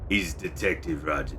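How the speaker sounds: a hint of a hard-bitten detective; Detective Roger voice. Shown as a demonstration. Streamer settling on his detective voice, a touch hard-bitten. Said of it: "It's a little hard bitten".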